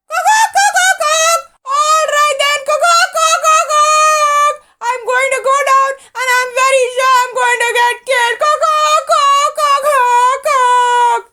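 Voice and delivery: high, loud wailing rooster, fast. A narrator's reading. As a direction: wail in panic like a doomed rooster. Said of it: "wailed Doodle-doo the rooster".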